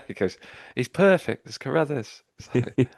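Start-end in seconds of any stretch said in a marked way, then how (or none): 0.8-2.2 mock delight. Quoting a character's delight.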